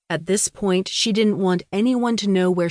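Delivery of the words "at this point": ordinary delivery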